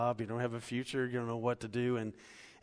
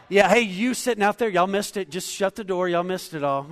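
louder, higher call to the back through laughter; calling out laughing; 7 semitones higher, 10 dB louder than usual. Real, not acted. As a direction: shout across the room, amused. Calling to people outside.

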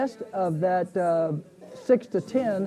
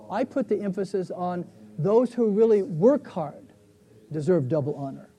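teacher marking the key phrase in the verse; stress on really work hard. Shown as a demonstration. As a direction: lean on really work hard so the class hears it. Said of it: "Did you hear the difference?".

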